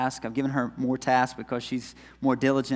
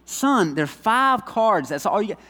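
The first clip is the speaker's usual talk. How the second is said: higher, louder dad telling off his son; a touch of a firm father; stern dad; 8 semitones higher, 5 dB louder than usual. Retold or imitated. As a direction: spell it out slowly to the boy. Dad scolding son about cards.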